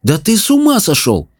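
incredulous. Acted. Simon scoffs at freeing the goblin. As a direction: scoff at the idea.

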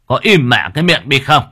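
loud, harsh scolding; angry snap. Acted. Chief turns and yells at whispering villagers. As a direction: bark at the gossiping crowd to shut up. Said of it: "quay lại quát".

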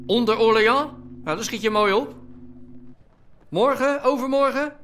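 loud, high shouting into a bad line; calling Hans over a crackling phone, shouting. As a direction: yell so the far end can hear you.

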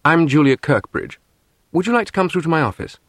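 put-on crisp English accent. A narrator's reading. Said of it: "The same crisp English accent".

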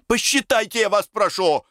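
high-pitched agitated insistence; insistent plea. A narrator's reading. Semyon begs the director to count, high insistent plea. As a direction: press him, pitch jumping right up.

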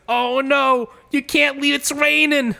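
high, hammed-up alarm in character; Turtle impression. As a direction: cry out in a high, put-on dismayed voice. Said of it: "And he goes".

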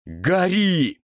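high roaring command. A narrator's reading. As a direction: roar the spell out. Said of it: "взревел он".

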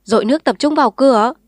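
loud, fast shouted order; commanding shout. A narrator's reading. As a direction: bark it out while charging in. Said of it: "vừa hô vừa xông vào".

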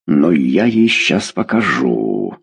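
low, slow, menacing croak; belligerent rasp. A narrator's reading. Father threatening to deal with his daughter, belligerent rasp. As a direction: growl the threat slowly. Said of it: "воинственно прохрипел Фёдор".

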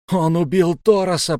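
high, loud repeated accusation; insistent shout. A narrator's reading. Youth repeating the accusation, shouting. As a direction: say it again, refusing to back down. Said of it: "повторил я".

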